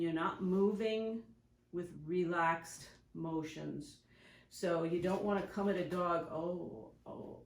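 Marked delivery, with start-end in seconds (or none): 6.3-7.4 soft nervous hesitant gasps, much quieter; timid fear. Shown as a demonstration. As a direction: come at the dog scared and unsure. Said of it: "you know, like this".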